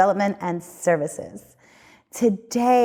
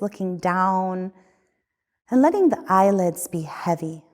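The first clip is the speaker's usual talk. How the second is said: guided meditation. Acted. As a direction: lead them gently through the body. Leads a guided body scan.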